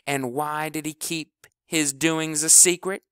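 loud, high furious rant. Acted. Kleebo rants at the team about House, loud and furious. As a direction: rail at the whole team.

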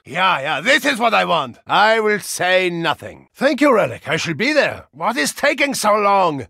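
ordinary talk, nothing marked

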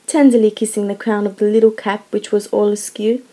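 usual speaking voice

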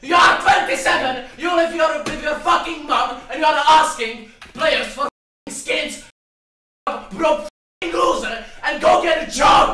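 screaming furiously at a teammate; high, loud enraged yelling; raging gamer. Real, not acted.

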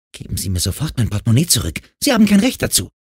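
fast, then jumping high and loud; rushed girl's outburst rising to a high yell; indignant demand. Acted. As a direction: build to an outraged protest. Girl angrily demands wallet back.

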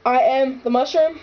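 loud, projected announcement; movie announcer. Acted. Told to announce a movie, loud announcer voice. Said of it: "like you were announcing a movie".

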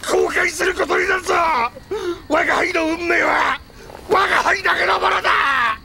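furious shout. Acted. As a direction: hurl the threat back at him. Furious threat to Nobunaga.

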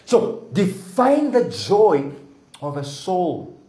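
loud, high preaching build; fervent sermon voice. Acted. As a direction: lift the congregation, preaching hard. Preacher rising to full force.

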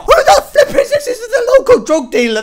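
high, loud laughing disbelief; laughing shock. Real, not acted. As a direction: shriek with laughter at the screen.

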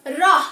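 রহ loud and low, ending in an h; hasanta ending. Shown as a demonstration. Teacher answers own question for pupils, রহ with hasanta ending. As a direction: give রহ firmly, closing it straight after the vowel. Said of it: "উচ্চারণটা কেমন হবে".

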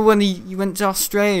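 ordinary talk

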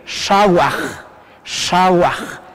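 "shawaj" with curled SH and a rubbed final J; careful pronunciation. Shown as a demonstration. Teacher insisting it be pronounced well, careful pronunciation. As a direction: say "shawaj" carefully, standing alone. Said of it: "hay que pronunciar bien".

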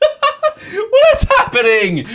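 very high, loud, drawn-out squeal; delighted disbelief. Real, not acted. Streamer overwhelmed by viewers' gifts, high disbelieving squeal.

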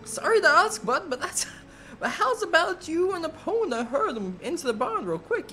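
high-pitched friendly rancher voice; cowboy rancher. Acted. Rancher apologizing to Link for the hurry, high folksy voice. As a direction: play the folksy ranch hand asking a favor.

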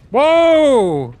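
very high, loud, long whoa; excited shout. Real, not acted. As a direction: whoop it out at full volume. Sudden thrill during the challenge.

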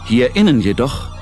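ordinary delivery

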